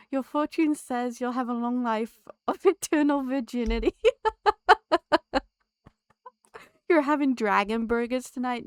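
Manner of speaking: loud, laughter spilling into the words; reading through laughter. Real, not acted. Streamer reading a rude fortune to chat, laughing. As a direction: try to read it out but keep cracking up. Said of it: "Oh, I'm laughing".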